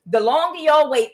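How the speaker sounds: usual speaking voice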